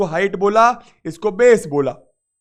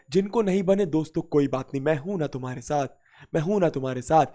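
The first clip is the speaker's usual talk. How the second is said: soft, low reassurance; gentle, low-voiced teacher encouragement; reassuring; 6 semitones lower, 8 dB quieter, faster than usual. Real, not acted. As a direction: drop the voice and comfort struggling students. Teacher reassures students who can't solve it.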